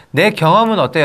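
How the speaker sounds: normal talking voice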